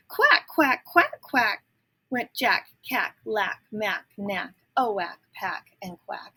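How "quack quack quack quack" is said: high, loud quacking, each one falling; loud duckling quacks. A narrator's reading. Ducklings answering their mother, loud high quacking. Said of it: "just as loud as their little quackers could quack".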